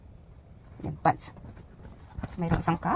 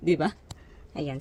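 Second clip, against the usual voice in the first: normal talking voice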